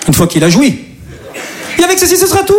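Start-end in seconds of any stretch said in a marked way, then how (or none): 1.8-2.5 high sing-song phrase; mock baker. Comedian imagining the baker saying it to her husband. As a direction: chirp it like she can't stop.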